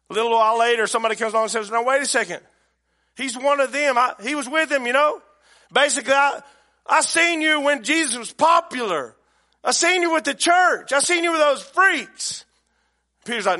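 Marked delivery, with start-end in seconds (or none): 1.7-12.4 high, loud, insistent bystander; acted accusation. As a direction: point him out loudly, building in insistence.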